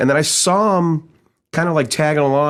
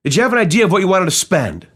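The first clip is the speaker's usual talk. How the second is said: eager sales question; 6 semitones higher, faster than usual. Retold or imitated. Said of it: "He said".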